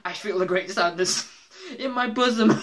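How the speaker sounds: high, loud reading through laughter; laughing his arse off. Retold or imitated. Imitating Homer reading the letter to his family, laughing. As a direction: crack up halfway through reading it out. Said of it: "he laughs his fucking arse off".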